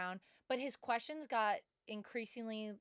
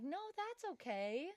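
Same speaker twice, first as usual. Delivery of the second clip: acted-out, over-nice brush-off; polite reassurance; 7 semitones higher than usual. Student telling a stranger he isn't disturbing her, politely reassuring. Reply to pushy stranger in story.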